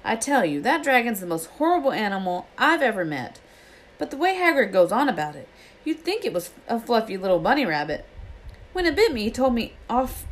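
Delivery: high-pitched, aggrieved rant; indignant complaint. A narrator's reading. Ron grumbles about the dragon that bit him, indignant. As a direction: complain with swooping high emphasis.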